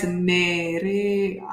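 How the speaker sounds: Nerea drawn out with a soft tapped R. Shown as a demonstration. Guest shows one R in her name, Nerea with a soft R. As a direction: say Nerea slowly, the R light and unrolled. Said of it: "I just pronounce it softly".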